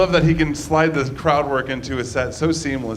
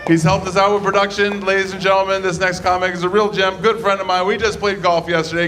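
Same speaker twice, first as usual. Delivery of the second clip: comedy club MC hyping the next act; announcer; 4 semitones higher, 3 dB louder than usual. Real, not acted. Host to the crowd bringing on the next comic, announcer build-up.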